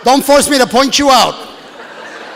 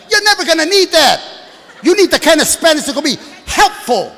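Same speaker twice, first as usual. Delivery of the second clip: normal talking voice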